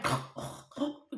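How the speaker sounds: choking cough, acted out; mock snotty choking. Caller crying on hold, exaggerated choking splutter. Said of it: "Choking on my own snot".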